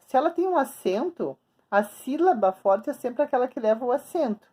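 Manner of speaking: normal talking voice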